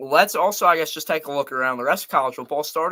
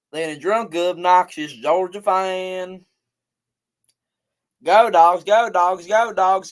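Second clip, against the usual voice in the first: slow, higher-pitched, drawn-out Georgia fan chant; terrible Georgia accent; 5 semitones higher, 4 dB louder, slower than usual. Acted. Host quoting a drunk Georgia fan's rhyme, hammed-up Georgia accent. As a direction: lay on a bad Georgia accent, stretching the line ends. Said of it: "that was my terrible Georgia accent".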